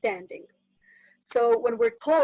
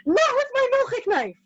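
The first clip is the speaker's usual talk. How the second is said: high, exasperated mother's scream; acted-out parental scream; screamed protest; 12 semitones higher, 4 dB louder than usual. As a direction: shriek in alarm at the boys. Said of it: "I have to scream".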